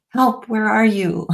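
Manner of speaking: a hint of a frightened child calling; mock cry for help. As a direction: call out like a small lost child. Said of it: "a part of us that's saying".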